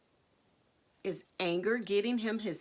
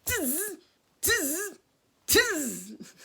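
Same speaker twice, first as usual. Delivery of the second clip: higher-pitched buzzing monkey chatter; mimicking monkeys; 5 semitones higher, much slower than usual. A narrator's reading. Monkeys shaking hands back at the peddler, teasing chatter. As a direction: tease him with short monkey noises. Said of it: "They shook both of their hands back".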